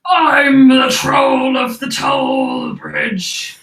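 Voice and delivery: slow, loud, booming troll proclamation; troll booming its title across the bridge; big booming voice. Acted. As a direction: boom it out slowly like a proud troll. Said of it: "Just say it with a big booming voice".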